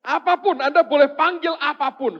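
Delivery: very high, very loud preaching; ringing high-pitched declaration; emphatic shout. Real, not acted. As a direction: proclaim it at full force. Preacher emphatically grants permission.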